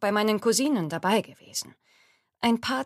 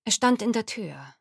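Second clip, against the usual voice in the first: plain reading voice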